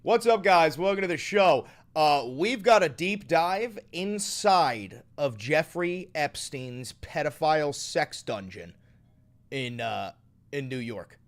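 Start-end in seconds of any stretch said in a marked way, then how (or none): 0.0-8.8 host intro. Acted. Opening the video, hyped show intro. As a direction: announce it like a show opener.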